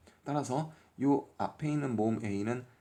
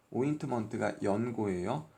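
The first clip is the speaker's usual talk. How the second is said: ordinary talk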